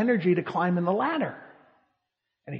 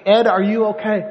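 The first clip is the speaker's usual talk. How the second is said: retold yell; 3 semitones higher, 7 dB louder than usual. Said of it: "my friend yells at me".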